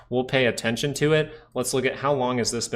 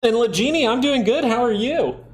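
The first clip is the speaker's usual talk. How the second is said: high-pitched voice; 13 semitones higher, 5 dB louder than usual. Pretend. Greeting a chat viewer.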